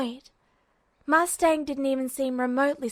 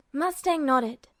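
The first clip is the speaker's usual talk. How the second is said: plain reading voice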